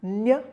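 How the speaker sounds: Ñ as a short "nya"; Ñ sound. Shown as a demonstration. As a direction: isolate Ñ, gliding from N into Y. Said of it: "becomes ñ".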